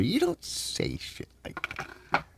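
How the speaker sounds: slow, breathy whisper under the breath; whispered aside. Real, not acted. To himself about pressure, a slow whispered aside. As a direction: drop to a slow whisper as if not meant to be heard.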